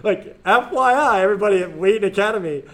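sarcastic proclamation to everybody at Wheaton Academy. Acted. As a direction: announce it with heavy irony. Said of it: "They're not supposed to use sarcasm".

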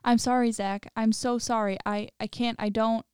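plain reading voice

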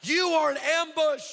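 high, driving sermon cadence; full-force preaching. Real, not acted. Preacher stirs the congregation about being used, full-force preaching. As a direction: build with rhythmic repeats to a ringing climax.

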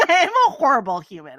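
laughing through a self-mocking admission; laughing confession. Real, not acted. Host admitting to co-hosts she's awful with pets, laughing. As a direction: own it while giggling.

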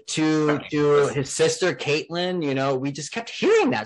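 normal talking voice

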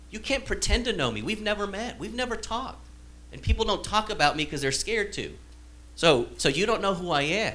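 preacher voicing Nathanael as he bristles at Jesus; defensive Nathanael. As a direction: push back like someone who feels exposed.

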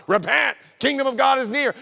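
imitated John the Baptist shouting; prophet's shout. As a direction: cry out like a wilderness preacher. Acted prophet calling to arriving people.

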